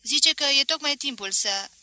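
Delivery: put-on boy calling in at the café, high and loud; eager message. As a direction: announce it breathlessly from the doorway. Boy delivers message to Voap.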